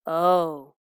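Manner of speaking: loud groaning oh. Acted. Wolf torn by hunger, loud groan. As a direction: groan it out, pained. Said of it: "groaned the old wolf".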